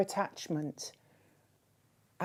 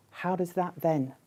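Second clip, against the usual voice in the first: usual speaking voice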